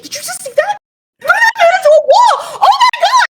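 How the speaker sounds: very high, loud excited shriek; fangirl squealing. Retold or imitated. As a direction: reenact shrieking at a thrilling fight. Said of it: "I'm, like, squealing".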